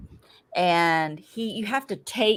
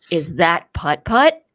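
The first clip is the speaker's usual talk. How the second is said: loud, slow mock-outraged question; half-screamed, drawn-out disbelief; retold scream; 7 dB louder, slower than usual. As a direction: re-enact a screamed question, rising at the end. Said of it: "And I even screamed".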